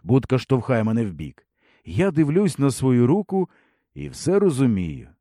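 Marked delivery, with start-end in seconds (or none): none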